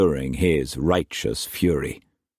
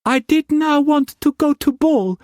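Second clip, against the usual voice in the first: very high, small pleading voice; tiny whisper; 4 dB louder than usual. A narrator's reading. Mei Mei excusing herself to Struan, tiny timid voice. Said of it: "she said in a tiny whisper".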